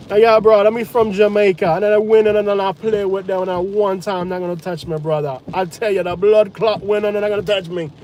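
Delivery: hammed-up Jamaican accent bragging; mock Jamaican accent. Imitating a local Jamaican shrugging off the storm, mock Jamaican accent. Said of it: "mock Jamaican local".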